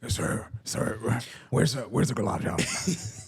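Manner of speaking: slow, put-on needy diner; buffet customer voice. Diner asking staff for gulab jamun, buffet voice. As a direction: badger the staff like a buffet fiend. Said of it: "do your best buffet voice".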